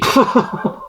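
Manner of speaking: quick unvoiced chuckle; short breathy laugh. Real, not acted. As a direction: laugh lightly at the joke. Laughing at own joke.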